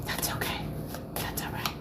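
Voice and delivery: soft whisper, no voice; whispered reassurance. Real, not acted. Streamer reassuring people joining who don't know her, whispering. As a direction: whisper it gently to shy newcomers.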